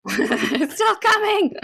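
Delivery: slow and breathless from laughing; cracking up while trying to talk. Real, not acted. Laughing at a gross image.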